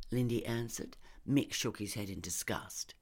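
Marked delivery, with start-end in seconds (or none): none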